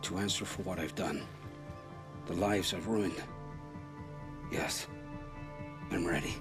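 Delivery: low, subdued acceptance; solemn resignation. Acted. Condemned man readies for execution, low and resigned. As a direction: accept the sentence quietly, with pauses.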